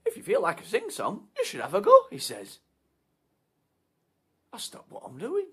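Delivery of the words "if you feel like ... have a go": higher and louder, breezy host; chirpy karaoke bloke. Acted. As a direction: chirp it like an eager entertainer.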